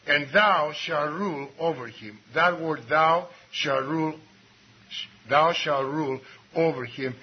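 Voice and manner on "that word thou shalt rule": usual speaking voice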